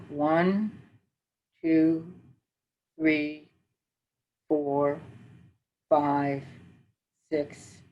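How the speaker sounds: slow, calm counting for a breathing exercise; guided breathing count. Shown as a demonstration. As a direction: count the breaths out slowly and calmly. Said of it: "I have slowed down my rate of speech".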